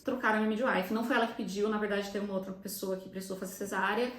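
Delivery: ordinary delivery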